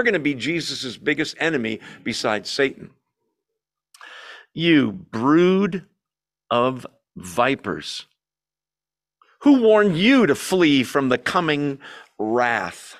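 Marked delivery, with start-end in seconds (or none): 4.5-13.0 louder, slow and weighty rebuke; half-hearted scream. Acted. John the Baptist rebuking the Pharisees, loud and slow. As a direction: call them out slowly, with force. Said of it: "I think he screamed it".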